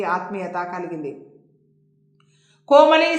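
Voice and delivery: lively, projected storytelling voice; story narration. A narrator's reading. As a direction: read the serial out with dramatic emphasis. Narrates an audio story episode.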